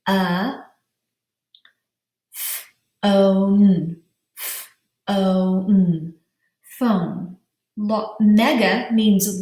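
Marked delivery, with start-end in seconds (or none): 0.1-0.6 "uh" as a lone schwa sound; isolated schwa. Shown as a demonstration. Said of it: "which is a schwa sound". 3.1-7.4 "F-O-N" as separate sounds, then "Phone"; "F-O-N" broken apart and rejoined as "Phone"; sounded out. Shown as a demonstration. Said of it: "pound out the syllables".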